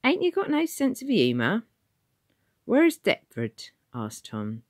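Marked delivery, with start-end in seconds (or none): 0.0-1.6 a cheeky city official quizzing a stranger; high Cockney ticket man. Acted. Ticket man questioning Tom, high and loud. 2.6-3.7 higher, slow countryman asking; a lost villager asking the way; rustic old Tom. Acted. Tom asking the ticket man, slow and high.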